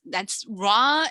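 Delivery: usual speaking voice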